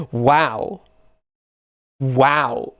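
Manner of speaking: ordinary delivery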